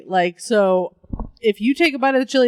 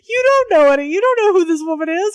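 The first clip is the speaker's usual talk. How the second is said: much higher, louder outraged shout; outraged rant; 10 semitones higher, 5 dB louder than usual. Acted. Scolding the kids for calling her bimbo, high outraged shout. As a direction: blow up at the brats in disbelief.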